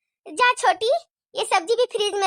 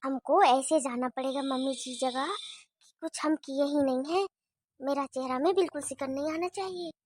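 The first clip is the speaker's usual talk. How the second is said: much softer, low sneaky muttering; conspiratorial aside; 6 semitones lower, 9 dB quieter than usual. Acted. Bahu plots to herself about the spilt oil, softer and lower. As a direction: plot under your breath. Plotting aside before mother-in-law.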